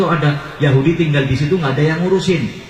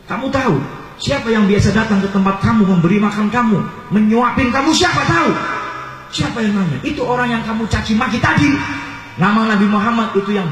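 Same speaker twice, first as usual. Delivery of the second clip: raised, high-pitched challenge; impassioned confrontation; 7 semitones higher than usual. Acted. Companion confronting the old man about whom he insulted, impassioned. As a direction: press the questions home in a high preaching voice.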